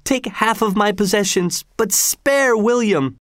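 crying out for mercy. A narrator's reading. Grandfather begging the governor for mercy, high-pitched pleading. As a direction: implore him, lifting the pitch throughout. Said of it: "he cried".

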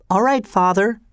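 a small son agreeing politely; young boy. Acted. Boy answers his father.

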